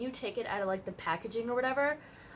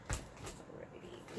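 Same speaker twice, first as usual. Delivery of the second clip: whispered aside; 15 dB quieter, much slower than usual. Real, not acted. Unvoiced aside while pulling wallet.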